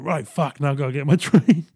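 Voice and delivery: amused panic at being late; flustered urgency. Retold or imitated. Passenger realising he must dash for his train, flustered. Retold sudden rush for train.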